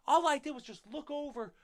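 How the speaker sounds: usual speaking voice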